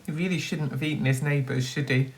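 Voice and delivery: ordinary delivery, nothing marked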